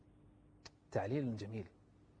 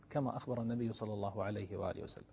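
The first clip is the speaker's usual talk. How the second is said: normal talking voice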